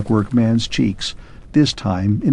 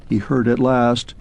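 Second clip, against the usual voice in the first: usual speaking voice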